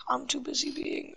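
ordinary talk

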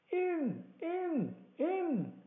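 high, repeated urging cry; each word a separate high-pitched call; urgent shout. A narrator's reading. Blind beggar urging on his hesitant men, high impatient shout. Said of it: "he shouted".

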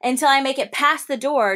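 ordinary delivery

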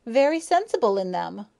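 spirited, indignant comeback; flashing retort. A narrator's reading. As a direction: fire back defiantly. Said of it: "flashed Maya".

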